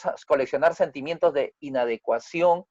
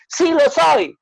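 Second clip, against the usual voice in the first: put-on high, loud boy's yell; shouting back; 12 semitones higher, 6 dB louder, slower than usual. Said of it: "gritando, levantando la voz".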